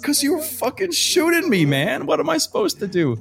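incredulous retort. Retold or imitated. Telling the policemen why he ran, mock indignant. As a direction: throw it back at them in disbelief. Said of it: "I'm like".

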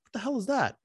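retold surprise, pitch jumping up; surprised. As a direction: react like something just caught your eye. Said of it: "I was like".

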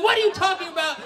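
high, loud protest; exasperated disbelief. Acted. Son protesting to both parents, exasperated disbelief. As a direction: yell it in bafflement.

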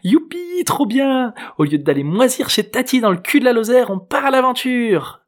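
put-on very high, gleeful teen cheering; enthusiastic girl. A narrator's reading. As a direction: celebrate like a girl setting off on an adventure. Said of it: "s'enthousiasma-t-elle".

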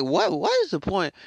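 high-pitched baffled outburst; exasperated disbelief. Retold or imitated. Reacting to pushy skeptics, in exasperated disbelief. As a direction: shoot the pitch right up in mock bafflement.

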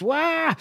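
thin, high retold scream; mock scream. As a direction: imitate a diving battle cry, half-voiced. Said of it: "and screams".

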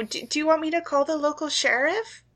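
high, gentle shop clerk; sweet small-town cashier; shopkeeper voice. Acted. GM voicing Shirley.

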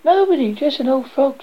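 put-on high, girlish princess voice; dismissive princess. A narrator's reading. Princess to the king about the frog, high and dismissive. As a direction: brush it off in a high voice. Princess brushes off the king's question.

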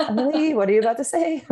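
high, quick, laughing mock apprehension; amused alarm. Coworker who knows her bracing for bluntness, amused alarm. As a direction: imitate a coworker giggling nervously at what's coming. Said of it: "see her kind of being like".

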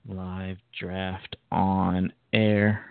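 very slow, drawn-out words; slow dictation. Real, not acted. Host setting up a live draft, dictating its title slowly. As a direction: spell it out word by word, stretching each.

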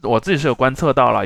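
ordinary talk

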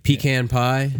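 pecan said slowly as PEE-can; slow questioning pecan, with a long ee: PEE-can; PEE-can pronunciation. Shown as a demonstration. Host testing it with pie, pecan as PEE-can. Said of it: "depends on what you're talking about".

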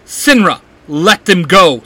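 a loud demanding shout. A narrator's reading. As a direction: order her to release them. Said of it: "Simon shouts".

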